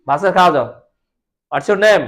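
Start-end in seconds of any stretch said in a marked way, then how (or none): none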